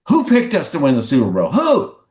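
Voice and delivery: retold outburst ending on a high bark; mock indignant demand. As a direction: imitate an irate coach demanding a name. Acts out coach's indignant demand.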